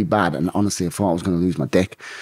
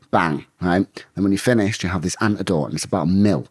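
usual speaking voice

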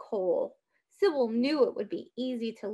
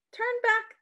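high urgent rallying cry; rally the neighbors, pitched up; cried out; 9 semitones higher than usual. A narrator's reading. Sybil rallying villagers with lanterns, crying out. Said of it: "Sybil cried".

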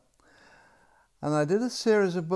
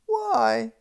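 put-on high, loud child's protest; indignant little princess; 8 semitones higher, 5 dB louder, slower than usual. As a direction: whine like a fed-up little girl. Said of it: "said the little princess".

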